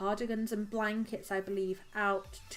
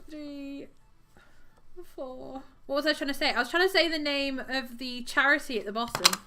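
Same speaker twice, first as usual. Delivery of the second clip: normal talking voice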